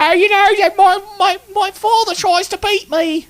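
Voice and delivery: shrill, loud, very high mock British accent; a terrible over-the-top British accent; horrible British accent. Host mocking the woman in a terrible British accent. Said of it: "That's a horrible British accent".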